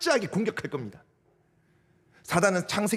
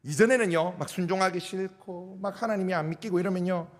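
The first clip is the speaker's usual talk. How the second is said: normal talking voice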